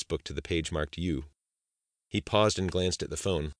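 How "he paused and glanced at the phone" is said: ordinary delivery, nothing marked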